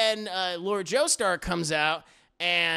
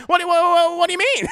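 much higher, louder shocked yelp; shocked disbelief; 12 semitones higher, 9 dB louder than usual. Retold or imitated. Podcaster reacting to Dio's threat, shocked yelp.

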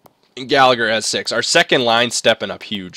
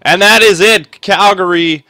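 louder, higher victory call; triumphant celebration; 7 semitones higher, 10 dB louder than usual. Real, not acted. Gamer celebrating the comeback win, triumphant. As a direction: announce the win like a champion.

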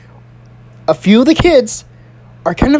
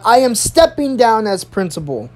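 measured address at usual pitch; principal's announcement. Retold or imitated. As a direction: imitate a principal addressing the class. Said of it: "She taps on the microphone. She says".

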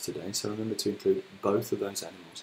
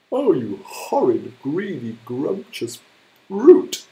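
building to a much higher, far louder shout on brute; angry hippo cry; 5 semitones higher, 11 dB louder, slower than usual. Acted. Hippo denouncing the greedy crocodile, escalating furious cry. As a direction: spit the insults and explode on the last word. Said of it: "cried Humpy Rumpy".

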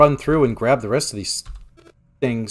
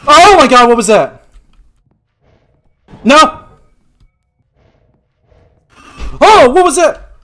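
high shrill screams; terrified screaming; 13 semitones higher, 11 dB louder than usual. Real, not acted. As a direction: shriek in fright. Jump scare in game.

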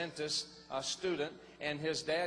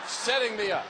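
emphatic repeat; 4 semitones higher, 8 dB louder than usual. Real, not acted. Preacher repeats point.

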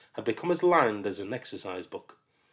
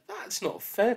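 put-on whiny child; 13 semitones higher than usual. Child protests.

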